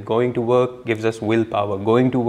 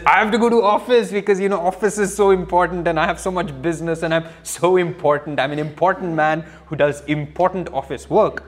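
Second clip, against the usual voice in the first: high, fast, puffed-up voice; mock pompous bragging, rushed; mock self-important; 5 semitones higher, faster than usual. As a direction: ham up a pompous busy man. Mocks a self-important office man.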